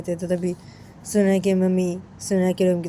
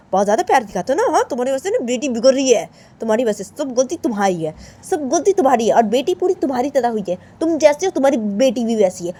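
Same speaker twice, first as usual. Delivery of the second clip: mother's loud, heated rant; angry scolding mother; 3 semitones higher, 8 dB louder than usual. Acted. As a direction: scold them insistently, building then settling. Mother rants at father and daughter. (गुस्से में डाँटती माँ)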